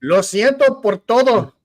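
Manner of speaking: hammed-up Spanish accent; mock Spanish. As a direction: roll it out like a mock Spanish speaker. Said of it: "that was the Spanish".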